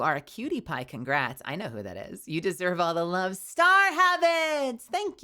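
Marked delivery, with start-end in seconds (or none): 3.4-5.2 high, slow drawn-out name; sing-song. Real, not acted. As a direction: stretch the name out, singing it.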